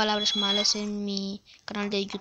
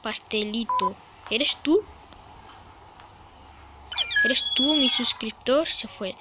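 ordinary talk, nothing marked

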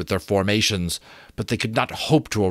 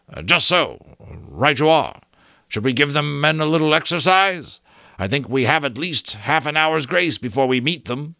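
a lightly higher, hurried officer's voice; hurried Granby voice; 6 semitones higher than usual. A narrator's reading. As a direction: rush it out, caught off guard. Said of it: "he said hurriedly".

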